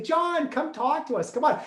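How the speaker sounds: high, eager come talk to us; imitating her sweet parents; sweet parents' invitation. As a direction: coax him in like a doting parent. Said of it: "They'd always say".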